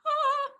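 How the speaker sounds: short, loud, high "ah"; sudden high squeal of excitement; high delighted squeal. Real, not acted. Host listing favourite action films to co-host, delighted squeal.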